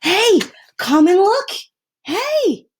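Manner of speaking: put-on high, loud boy's call; a kid yelling from the bedroom; excited child calling. A narrator's reading. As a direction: shout for the family to come see. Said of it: "called from the bedroom".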